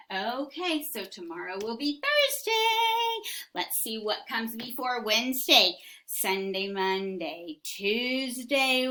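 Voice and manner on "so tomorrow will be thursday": high sing-song. Acted. Sing-song teacher line.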